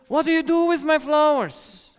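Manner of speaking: high, loud indignant outburst; angry boyfriend. Acted. Boyfriend finding his gift in the trash, high angry shout. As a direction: snap it out like an offended boyfriend.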